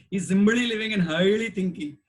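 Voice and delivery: put-on light South Indian accent, joking; mock South Indian accent, playful. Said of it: "for those who with the South Indian accent".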